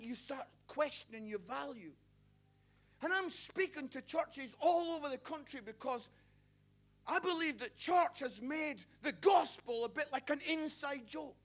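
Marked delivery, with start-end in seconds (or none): 3.0-11.4 loud, driving preacher voice; preaching at full force. Acted. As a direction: preach it hard to the crowd.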